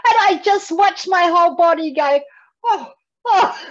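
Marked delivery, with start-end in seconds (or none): 2.6-3.6 high, loud swooning oh; swooning reaction. Retold or imitated. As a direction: re-enact going weak at hearing an accent. Retells a thrilled reaction to accent.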